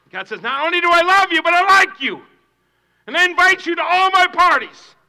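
loud, high fervent voicing of God's invitation; preaching at full force. Pastor voicing God's invitation to the church. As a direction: proclaim it with joy.

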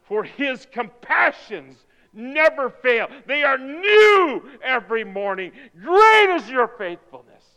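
loud, high, ringing scripture; triumphant proclamation. Acted. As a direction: proclaim each promise at full force.

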